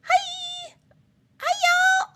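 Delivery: way up high, bright hellos; excited high-pitched greetings; high singsong greeting. Real, not acted. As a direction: call out hi in a high sing.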